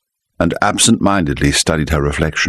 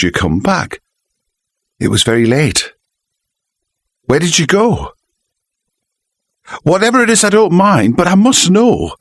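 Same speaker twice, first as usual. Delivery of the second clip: pleading wife; 8 semitones higher than usual. A narrator's reading. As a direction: beg him to tell the truth. Wife's voice in story.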